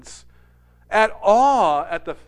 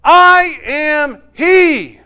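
very slow, higher, louder proclamation; solemn declaration; 7 semitones higher, 11 dB louder, much slower than usual. Retold or imitated. Jesus revealing himself to the woman, slow and solemn. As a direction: let each word land like a bomb. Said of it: "Jesus looks at her and says".

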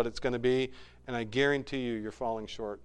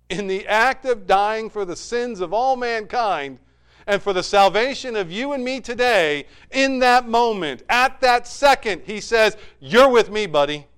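higher, louder climax of the sermon; preaching at full force; 7 semitones higher, 10 dB louder than usual. Acted. Pastor proclaiming Jesus' promise to the thief, impassioned. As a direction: build it up to a peak.

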